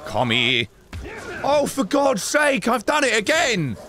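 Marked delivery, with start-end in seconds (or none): 1.4-3.8 exasperated outburst. Real, not acted. Annoyed at own mistake.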